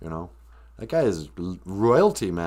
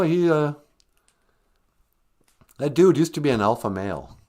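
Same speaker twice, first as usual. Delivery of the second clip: normal talking voice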